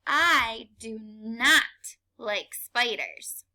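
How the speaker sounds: slow, leaning on not; teacher model sentence, slowed. Shown as a demonstration. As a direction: read it slowly to the class. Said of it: "read the sentence".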